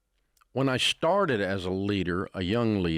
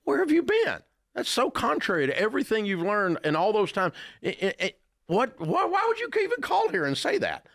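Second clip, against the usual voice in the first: much higher, incredulous exasperated rant; exasperated disbelief; 12 semitones higher than usual. Retold or imitated. Host retelling his reply to a caller wanting a car loan, high incredulous exasperation. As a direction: throw up your hands at the caller's nonsense. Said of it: "And I'm like".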